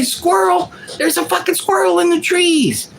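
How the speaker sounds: high, frantic squirrel alert; excited dog. Retold or imitated. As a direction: squeal it like a dog spotting a squirrel. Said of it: "You say".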